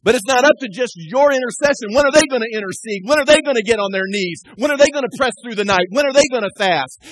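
loud, driving preacher cadence; fired-up pulpit challenge; preaching at full force. Acted. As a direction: hammer each question at the church. Preacher pressing the congregation.

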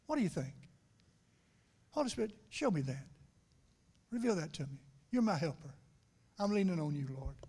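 soft, hushed prayer to a close friend; intimate prayer. Pretend. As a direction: pray it quietly, leaning on him. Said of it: "to be able to say to the Holy Spirit".

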